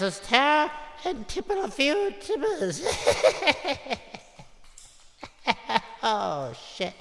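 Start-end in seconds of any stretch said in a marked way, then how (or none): 2.9-4.2 high-pitched hammy cackle; gleeful high cackling laugh; ghoulish cackle. Acted. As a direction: cackle like a horror host. Horror host cackles at own joke.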